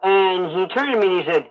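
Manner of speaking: ordinary delivery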